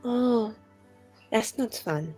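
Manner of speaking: normal talking voice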